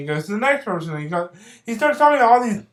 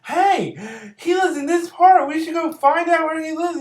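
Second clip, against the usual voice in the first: eager bearded guy; 10 semitones higher than usual. Retold or imitated. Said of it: "the bearded guy finds it so interesting".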